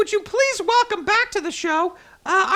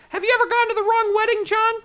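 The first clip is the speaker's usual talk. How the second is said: ordinary delivery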